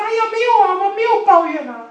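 high, loud protesting whine; whiny denial. Retold or imitated. Israelites deny complaining to Moses, high loud whine. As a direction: protest your innocence with a big high whine. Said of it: "他说".

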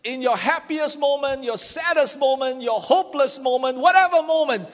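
rising, forceful sermon delivery; preaching. Acted. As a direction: preach it out to the crowd. Preaching climax, shouting.

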